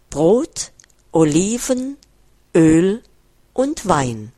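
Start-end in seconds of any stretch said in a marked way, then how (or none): none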